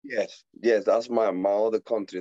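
ordinary talk

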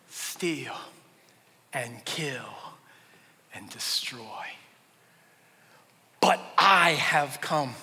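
slow, hushed, low and weighty; solemn scripture reading. Acted. Preacher quoting what Jesus says, solemn and slow.

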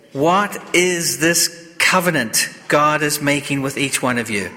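normal talking voice

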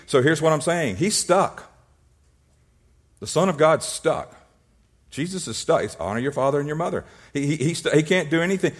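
ordinary talk

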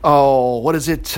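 ordinary delivery

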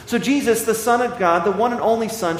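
usual speaking voice

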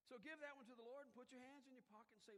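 usual speaking voice

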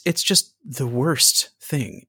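ordinary talk

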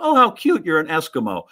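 high, cutesy imitation of a neighbor lady; cooing lady. Lady at the door greets a costumed kid, cooing imitation. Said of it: "she looks at me and goes".

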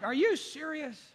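exasperated fan. Retold or imitated. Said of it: "people turn around like".

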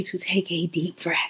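soft breathy whisper. Real, not acted. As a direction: whisper the cue gently. Starting breathing cue, unvoiced.

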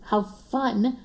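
sarcastic, pitch lifted on how fun; mock cheer that means the opposite. Repeating the joke about homeschooling, sarcastic. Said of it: "I said that very sarcastically".

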